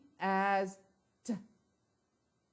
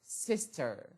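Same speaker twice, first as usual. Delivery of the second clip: ordinary delivery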